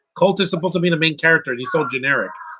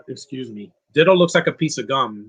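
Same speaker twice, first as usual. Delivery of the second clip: ordinary talk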